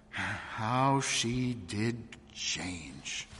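very slow, soft sighing reflection; sigh, then let each word drag quietly; wistful regret. A narrator's reading. Wistful recollection of a changed friend.

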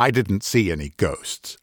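usual speaking voice

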